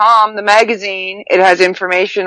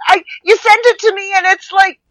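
whiny complaint; 11 semitones higher than usual. Real, not acted. Complaining about the long password.

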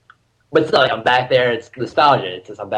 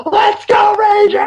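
high, loud scream to the crowd; full-voiced, high-pitched cheer; retold scream; 5 dB louder, slower than usual. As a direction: scream it out like a rallying cry. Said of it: "I scream".

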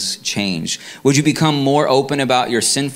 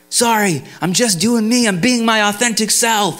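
higher, breezy mock voice of a self-absorbed guy; lightly hammed-up careless shrug of a voice; a hint of a blissfully oblivious neighbor; carefree metal-head neighbor; 9 semitones higher than usual. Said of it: "And he's like".

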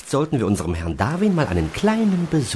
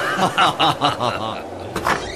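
1 gleeful laugh; 4 semitones higher than usual. Acted. Storm makers enjoy the chaos.

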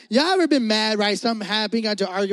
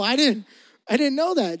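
ordinary delivery

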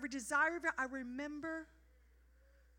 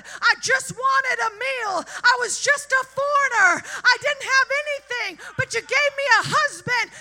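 much higher and louder, crying out; tearful shouted praise; 7 semitones higher, 11 dB louder than usual. Pretend. Preacher as Ruth, crying out thanks to God. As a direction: shout your thanks through tears. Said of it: "And she's like".

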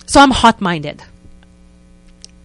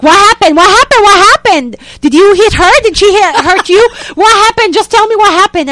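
high and loud, urgent repeated questions; panicked husband shaking his wife; frantic husband; 9 semitones higher, 7 dB louder than usual. Retold or imitated.

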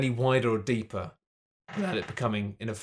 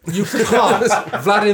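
loud laughing outburst; laughing loudly; 8 semitones higher, 11 dB louder than usual. Real, not acted. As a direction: laugh it out, can't help it. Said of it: "It just comes out like that".

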